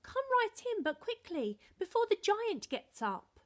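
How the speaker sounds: loud, high hurried giantess; urgent giantess. A narrator's reading. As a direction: hurry him in, booming but anxious. Giantess urging Jack inside.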